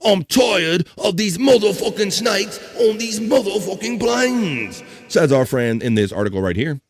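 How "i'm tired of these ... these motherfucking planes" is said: lightly put-on Australian accent. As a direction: say it like an Aussie, higher. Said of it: "in an Australian accent this time".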